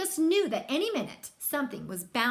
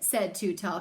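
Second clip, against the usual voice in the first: plain reading voice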